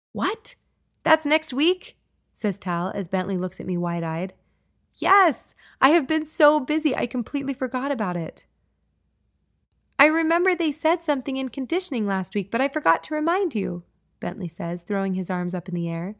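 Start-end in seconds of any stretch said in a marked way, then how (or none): 0.1-2.0 much higher, rising disbelief; surprised kid. Acted. Son surprised the celebration is next week, much higher. 4.9-8.5 much higher and louder burst; excited yes. Acted. Mom confirming the news to her sons, much higher and louder. 10.0-13.8 much higher, eager tumbling words; excited kid. Acted. Young son explaining he forgot, much higher and eager.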